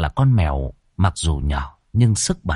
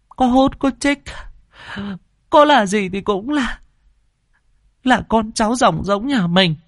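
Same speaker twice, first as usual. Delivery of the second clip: put-on a high, tearful old woman pleading her case; tearful old mother; 14 semitones higher than usual. A narrator's reading. Grandmother answering her son with tears, in a high tearful old voice. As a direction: voice the grandmother choking back tears. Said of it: "nước mắt ngắn dài lã chã".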